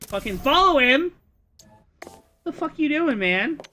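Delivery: high, loud outburst; exasperated yelling. Acted. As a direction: yell it in disbelief. Exasperated shouting at player.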